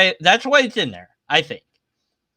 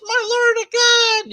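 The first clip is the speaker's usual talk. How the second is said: much higher, hammed-up cry of "My Lord and God"; mock awestruck Thomas. Thomas to Jesus, hammed-up awestruck cry. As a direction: squeal it like a stunned believer.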